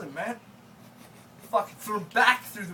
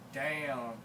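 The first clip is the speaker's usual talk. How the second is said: normal talking voice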